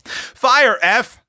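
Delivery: high, urgent command; girl's high-pitched, decisive cry; firm cry. A narrator's reading. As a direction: call out the order firmly. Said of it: "cried Tilly firmly".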